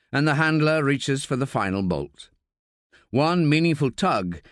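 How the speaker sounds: usual speaking voice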